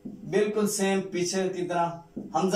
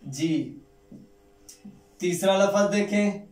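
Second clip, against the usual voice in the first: usual speaking voice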